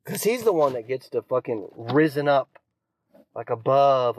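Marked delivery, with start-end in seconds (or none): none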